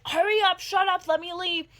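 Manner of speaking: loud, high, desperate yell; frantic shout. Retold or imitated. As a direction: scream at her to hurry up. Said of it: "And I'm just like".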